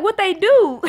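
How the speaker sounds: put-on high, loud kid question; imitated son. Son questions mama about veins, loudly curious. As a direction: blurt it like a curious child. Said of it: "He was like".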